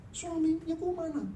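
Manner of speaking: panicked question. Retold or imitated. Wife asks nurse, panicked.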